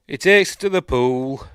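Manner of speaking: loud, high push through tears; choked up. Real, not acted. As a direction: force the story out while welling up. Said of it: "I can't".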